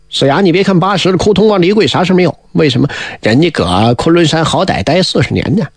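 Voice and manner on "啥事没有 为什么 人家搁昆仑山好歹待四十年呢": ordinary delivery, nothing marked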